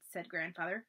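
ordinary delivery, nothing marked

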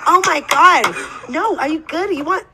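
high, eager apologetic politeness; overly nice. Retold or imitated. Guest mimics the polite Canadians she met on her trip, overly nice. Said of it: "everybody was nice".

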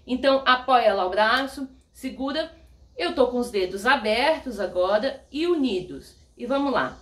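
ordinary talk, nothing marked

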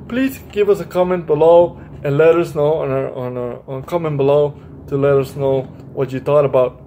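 ordinary delivery, nothing marked

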